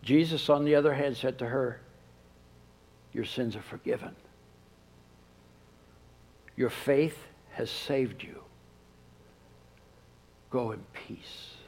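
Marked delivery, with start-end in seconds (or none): 3.1-11.6 gentle absolution. Acted. Jesus forgiving the woman.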